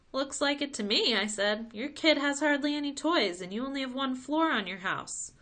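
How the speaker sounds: put-on high, loud childish bluntness; a boy bluntly telling the parents; blunt kid. A narrator's reading. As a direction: state it like an innocent kid. Crash bluntly tells parents.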